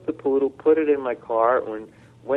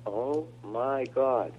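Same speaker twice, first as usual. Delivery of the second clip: slow, soft, stunned murmur; awed under the breath; 7 dB quieter, much slower than usual. Retold or imitated. To himself, hearing the dog healed: soft stunned awe. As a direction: say it to yourself, slowly, in disbelief. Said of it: "I said to myself".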